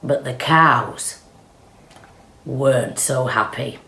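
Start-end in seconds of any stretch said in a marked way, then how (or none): none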